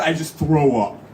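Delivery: ordinary delivery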